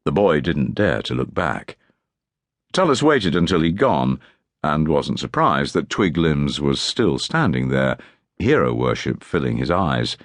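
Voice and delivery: usual speaking voice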